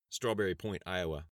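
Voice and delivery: ordinary delivery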